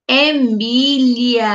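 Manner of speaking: Emília drawn out slowly, syllable by syllable; slow teaching word. Shown as a demonstration. Teacher to young pupils, spelling out the name slowly. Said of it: "o nome dela é".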